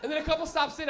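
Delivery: usual speaking voice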